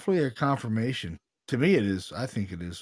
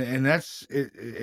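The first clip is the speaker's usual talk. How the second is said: ordinary talk, nothing marked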